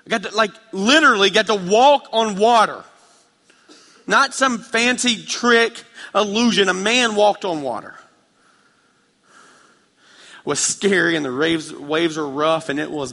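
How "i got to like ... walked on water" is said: higher and louder, hammering the point; preaching at full force. Real, not acted. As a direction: preach it big, full conviction. Preaching climax.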